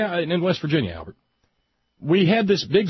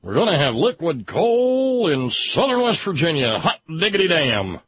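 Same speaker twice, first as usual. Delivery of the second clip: mock press release. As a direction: read it like a proud yokel announcement. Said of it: "press release".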